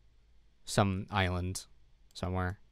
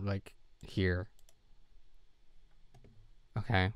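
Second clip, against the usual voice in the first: ordinary delivery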